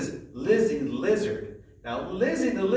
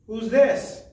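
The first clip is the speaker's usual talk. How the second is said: loud high-pitched question; high loud quiz; 7 semitones higher, 6 dB louder than usual. Shown as a demonstration. Teacher quizzing children loudly and high. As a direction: call it out brightly to the class.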